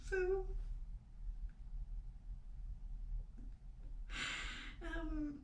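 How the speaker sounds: tearful pleading. Real, not acted. Recalling her promise to God to stop fires, tearful. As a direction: plead through tears, trailing away.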